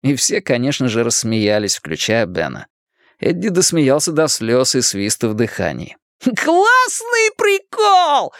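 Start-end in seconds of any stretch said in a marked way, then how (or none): 6.2-8.3 very high, stretched-out holler; drawn-out high-pitched whoop; exultant shout. A narrator's reading. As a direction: crow it like a referee calling a score. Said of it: "кричал Ричи".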